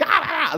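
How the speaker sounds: high mock yell; imitated shouting. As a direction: yell out as if over engine noise. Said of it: "shouting, going".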